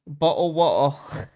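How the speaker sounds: loud, slow British "Bottle water" without T's; mock British accent. Joking after British accent talk, mock British accent. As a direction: drop both T's, hammed-up British accent.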